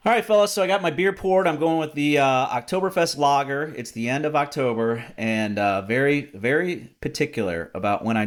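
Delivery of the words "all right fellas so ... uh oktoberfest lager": cheerful. Real, not acted. Cheery beer toast.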